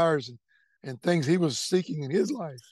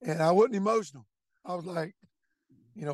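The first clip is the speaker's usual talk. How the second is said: normal talking voice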